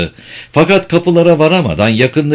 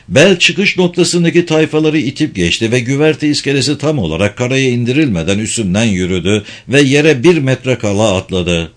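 plain reading voice

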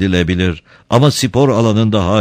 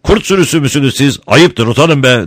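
high, loud scolding shout; 8 semitones higher, 4 dB louder than usual. Acted. Don Camillo shouting at the mob to be ashamed. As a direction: shame them with a roar. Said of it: "diye bağırdı Don Camillo".

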